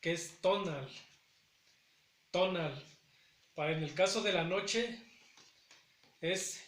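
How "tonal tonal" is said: "tonal" said as TO-nal, first syllable stressed. Shown as a demonstration. Teacher to Náhuatl students, modelling "tonal" stressed on TO. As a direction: demonstrate "Tonal" with the weight on TO. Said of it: "el acento va a recaer en la primera sílaba".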